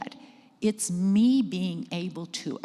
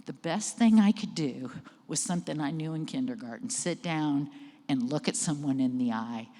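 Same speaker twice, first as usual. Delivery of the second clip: normal talking voice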